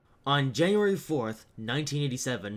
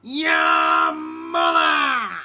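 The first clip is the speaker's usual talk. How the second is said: drawn-out screaming insult; 9 dB louder, much slower than usual. Acted. Train shouts insult again.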